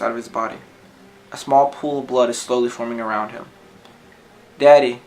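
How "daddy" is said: put-on loud, desperate cry of a child; a daughter screaming for her shot father. A narrator's reading. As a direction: scream it for all she's worth. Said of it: "screams at the top of her lungs".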